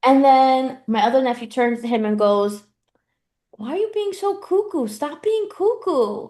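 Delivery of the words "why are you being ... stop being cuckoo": imitating a small child. Nephew voiced in a story.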